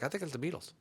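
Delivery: soft, unsure imitation of a listener; quiet mock-clueless guessing. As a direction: guess offhandedly, like someone who doesn't know music. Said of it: "they'll be like".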